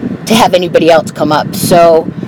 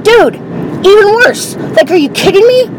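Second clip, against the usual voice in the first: high-pitched, incredulous comeback; exasperated retort, pitched high; retold incredulity; 11 semitones higher than usual. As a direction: act out disbelief with the voice jumping way up.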